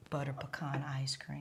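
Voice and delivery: soft teasing whisper. Acted. Host whispering the ice cream treat to her guest.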